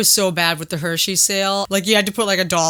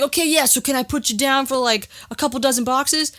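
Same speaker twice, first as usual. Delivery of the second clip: phone customer; 6 semitones higher than usual. Retold or imitated. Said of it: "when the guy comes back".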